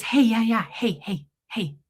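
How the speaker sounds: mock doctor's hesitant opener; doctor impersonation. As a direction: stall awkwardly with repeated greetings. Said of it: "impersonate exactly how he did this conversation".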